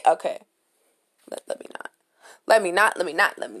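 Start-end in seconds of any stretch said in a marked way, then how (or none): none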